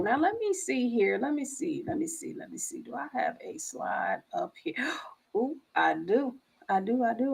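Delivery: soft, trailing off almost to nothing; talking to oneself. Real, not acted. Host checking for a slide, talking to oneself softly. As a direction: murmur it under your breath while searching. Muttering to self while searching slides.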